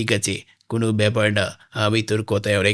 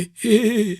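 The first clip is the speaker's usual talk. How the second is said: laugh; 12 semitones higher, 3 dB louder than usual. Acted. Alone fretting over broken tractor.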